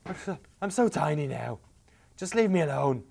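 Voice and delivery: mild whiny sulk. Acted. Voicing the shrunken king sulking.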